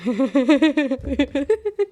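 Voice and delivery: high, loud helpless laugh; loud burst of laughter. Real, not acted. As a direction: crack up at the book.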